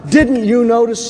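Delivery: slow, loud mock shout; retold shout. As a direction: fling it back at the reporter, loud and slow. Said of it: "And the corpsman shouts out".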